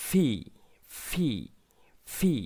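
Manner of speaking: ফি said with an aspirated "p", a puff of air, then "ee"; ফি repeated, the "p" breathy and not voiced like "v"; ফি stressed like English "pee", with air after the "p"; correct letter P. Shown as a demonstration. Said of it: "পি এর সঠিক উচ্চারণটা হবে".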